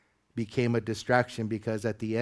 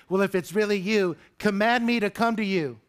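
slightly dramatized Peter, higher-pitched; Peter speaking up; 8 semitones higher, 4 dB louder than usual. Acted. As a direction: call out a challenge as Peter, pitched up. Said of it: "Peter speaks up out of all the disciples".

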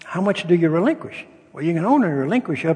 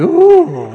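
a high, loud wince that breaks into a laugh; pained laughing ooh; 14 semitones higher, 13 dB louder than usual. Real, not acted. Pastor recalling the call to Fred, a pained laughing ooh. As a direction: squeal at the sting of confessing.

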